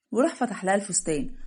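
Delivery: usual speaking voice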